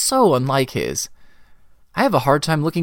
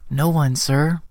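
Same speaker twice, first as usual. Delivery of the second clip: ordinary delivery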